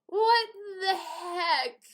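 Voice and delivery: high, loud and dragged out; whiny mock outrage, stretched slowly; exasperated disbelief. Sister puzzling over her secret present, exasperated disbelief. Retold exasperated protest to brother.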